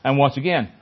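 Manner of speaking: usual speaking voice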